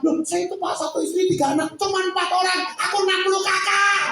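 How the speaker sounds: mock exasperated rant. Voicing his own outburst at callers.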